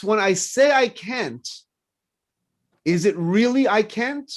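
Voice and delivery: ordinary talk, nothing marked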